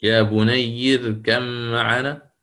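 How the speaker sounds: يا بني اركب معنا said slowly, with the راء in اركب kept thin after the kasra; slow demonstration. Shown as a demonstration. Teacher models the thin راء for the class, slowly. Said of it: "أليست الراء الساكنة سبقت بكسر".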